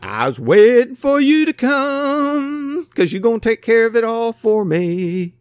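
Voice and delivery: high, put-on whiny servant voice; imitating a servant making excuses; mock lazy servant. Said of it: "you're like".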